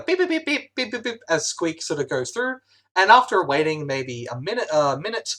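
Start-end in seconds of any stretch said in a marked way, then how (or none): 0.0-1.2 lightly voiced high robot beeps; droid beeps. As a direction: GM lets the droid beep, a little higher.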